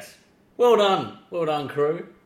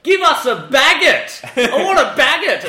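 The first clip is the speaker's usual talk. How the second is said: loud, high, slow bogan demand; bogan in a French bakery; 13 semitones higher, 8 dB louder, slower than usual. Retold or imitated. Bogan yelling at a Paris baker, loud high demand. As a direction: bellow for bread like a clueless tourist. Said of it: "walk into a bakery ... and be like".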